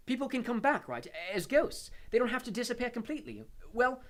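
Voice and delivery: high, loud and pushing; impatient. A narrator's reading. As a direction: demand it impatiently. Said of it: "he added impatiently".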